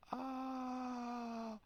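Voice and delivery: long, sustained high "aah"; high "ah" of revelation. Retold or imitated. Man realizing he is light, enlightened "ah". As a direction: hold a high note of sudden understanding. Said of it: "I have this massive realization".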